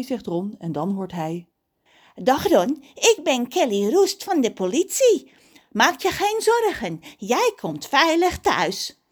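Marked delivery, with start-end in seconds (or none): 2.1-9.0 high, over-articulated official voice; policewoman Kelly. A narrator's reading. Police officer reassures Ron by phone, high and emphatic. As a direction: draw out each phrase firmly.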